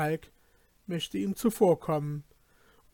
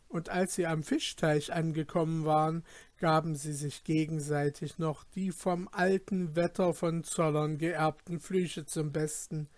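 ordinary delivery, nothing marked

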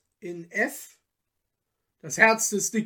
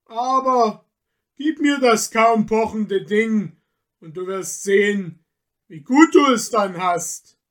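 louder, mocking forest spirit; scornful tempter; 3 semitones higher, 8 dB louder than usual. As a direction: lecture him with contempt. Michel tempts Peter.

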